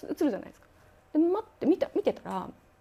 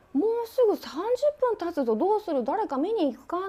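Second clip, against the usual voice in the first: higher, louder, anxious friend urging; worried raised voice; 7 semitones higher, 4 dB louder than usual. Retold or imitated. Friend urging the group to go check, worried and raised. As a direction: push the group to act, uneasy.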